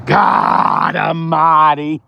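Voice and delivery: slow, stretched exclamation of dismay; stunned drawl. Real, not acted. Dealer looking inside the car, slow stunned groan. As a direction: drag it out in disbelief.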